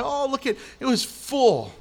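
normal talking voice

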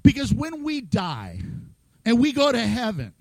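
usual speaking voice

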